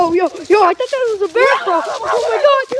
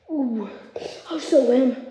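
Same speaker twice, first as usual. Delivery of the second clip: normal talking voice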